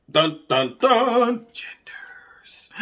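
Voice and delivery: whispered ominous reveal; dramatic dun dun dun. Pretend. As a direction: hush it like a dramatic sting. Dramatic reveal sound effect.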